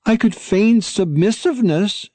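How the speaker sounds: a pup offering an idea brightly; eager pup offering to play meek; eager young dog. A narrator's reading. Hulicalabuli eager to help.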